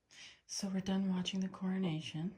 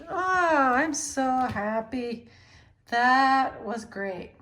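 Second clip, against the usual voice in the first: happy; 5 semitones higher, 12 dB louder, much slower than usual. Real, not acted. Joy as cat comes out.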